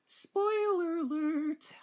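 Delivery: very high, drawn-out squeal; mock warning. Game fan warning of spoilers, high mock squeal. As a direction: stretch it out in a silly high voice.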